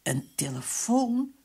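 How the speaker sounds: high, slow surprised question; called out. A narrator's reading. Willemse hearing the telephone, calling out. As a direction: call it out, rising in surprise. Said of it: "riep Willemse".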